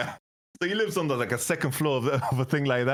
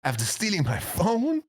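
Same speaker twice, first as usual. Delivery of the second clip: laughing disbelief; 7 semitones higher than usual. Real, not acted. To his friend, laughing in disbelief that the thief came back.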